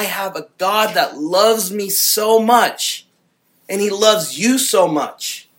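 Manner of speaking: loud, driving pulpit preaching; preaching at full force. Acted. Pastor rousing his congregation, preaching at full force. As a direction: preach it hard to the room, building fervour.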